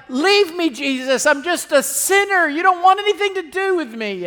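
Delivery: pleading Peter. Retold or imitated. Peter begging Jesus to leave him, high and pleading. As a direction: plead to be left alone, ashamed. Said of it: "Peter's like".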